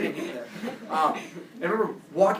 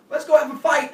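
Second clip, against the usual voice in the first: high, loud crowd-style call; rally the team like an eager mob; imitated team rallying cry; 10 semitones higher, 9 dB louder than usual. Whole team rallies to fight.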